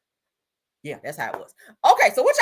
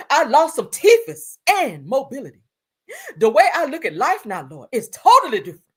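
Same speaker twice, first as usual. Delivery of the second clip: [name]; 4 semitones higher, 7 dB louder than usual. Retold or imitated. Reading a singer's post aloud, overdramatic. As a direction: punch and stretch the words in mock distress. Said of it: "I gotta read it in her voice".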